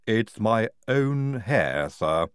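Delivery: slow, lisping Igorina voice; drawn-out lisped reply. A narrator's reading. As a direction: say it slowly with a lisp. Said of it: "dropping most of the lisp".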